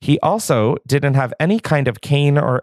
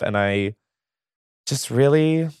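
ordinary delivery

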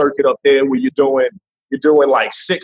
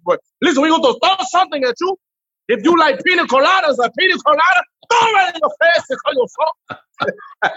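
put-on Dominican accent; 12 semitones higher, faster than usual. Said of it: "for Dominicans".

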